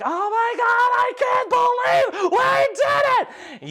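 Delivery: very high, excited squeal; overjoyed cry. Acted. Sav being picked up by cheering crew, squealing with joy. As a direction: cry out in high-pitched disbelief. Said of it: "you can hear him be like".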